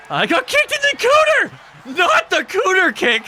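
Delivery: squeaky yelled character voice. Pretend. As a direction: shriek like a cartoon fighter getting hit. Game character voice yelling.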